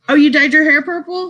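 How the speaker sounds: oh really, a delighted double take; pleased surprise at the news; surprised. Real, not acted. Reacting to viewer's purple hair.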